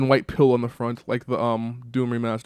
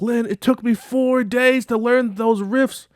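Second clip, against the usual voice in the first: much higher, slow whining plea; whiny complaint; 13 semitones higher, 6 dB louder, slower than usual. Retold or imitated. Ted whining to Lin about learning the riffs, high and slow. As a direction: stretch it out like a wounded puppy.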